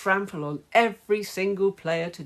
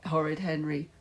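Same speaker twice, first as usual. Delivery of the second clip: plain reading voice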